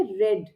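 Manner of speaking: "रेड" said with a short e, like "red"; past tense pronunciation. Shown as a demonstration. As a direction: say "रेड" clearly as the past form. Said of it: "भूतकाल में इसे pronounce करते हुए हम कहेंगे". ("रेड" को छोटे ए के साथ "रेड" बोलें)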